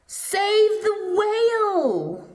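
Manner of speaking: very high, loud triumphant call; rallying cry. Acted. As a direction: sing it out like a banner. Trail's message read out.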